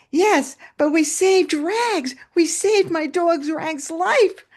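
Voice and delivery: high, loud, drawn-out triumph; excited exclamation. Retold or imitated. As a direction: imitate an excited young man proclaiming a rescue. Former pupil blurts the triumph.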